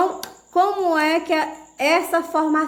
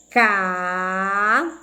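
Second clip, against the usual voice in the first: "Ca-chim-bo" in three drawn-out pieces, long gaps; low, slow CAAA... CHIIIM... BO; syllable by syllable; 4 semitones lower, much slower than usual. Shown as a demonstration. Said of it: "nós vamos fazer a separação silábica".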